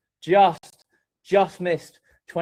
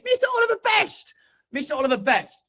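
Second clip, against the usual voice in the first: excited name reveal. Real, not acted. Announcing bronze winner name.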